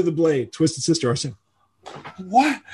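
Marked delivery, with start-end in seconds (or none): 2.2-2.6 high, rising "What?"; retold astonishment, pitched well up; stunned disbelief. Singer sees his band at number one, stunned disbelief. Retells shock at topping chart.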